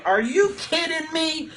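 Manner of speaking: mock old complainer. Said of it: "I'm like".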